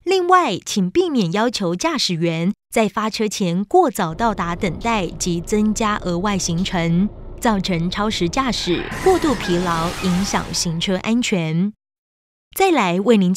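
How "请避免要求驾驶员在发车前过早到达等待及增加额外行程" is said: normal talking voice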